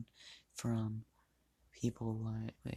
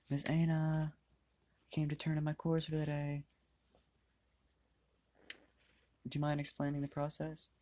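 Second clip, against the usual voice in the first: mock yell; 5 semitones higher than usual. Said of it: "she just screams this through the entire facility".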